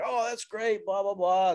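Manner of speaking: high, chirpy mock-cheerful chief voice; imitating Dan Jones. Fire chief Dan Jones waving it through, mock cheerful. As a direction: toss it off in a bright mock-chief voice. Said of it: "He's like".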